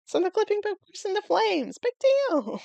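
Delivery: very high squeaky exclamation; mock squeal. Retelling an absurd plot moment, mock squeal.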